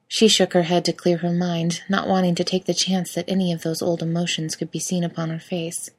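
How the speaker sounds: ordinary delivery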